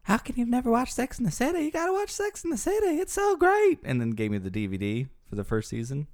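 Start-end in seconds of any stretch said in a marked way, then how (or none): 0.0-3.8 much higher, gushing insistence; insistent friend. Retold or imitated. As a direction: push the show like a superfan. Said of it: "another one of my friends".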